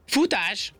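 high, urgent call to flee; commanding. A narrator's reading. Rabbit ordering the ant to flee, commanding. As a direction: fire off a high-pitched order. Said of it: "vezényelt a nyúl".

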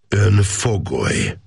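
Ön fogoly said slowly, each word pressed hard; officer pressing Ön fogoly, slow and firm; stressed words. A narrator's reading. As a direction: lean on Ön and on fogoly. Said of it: "erőteljesen megnyomva a szavakat".

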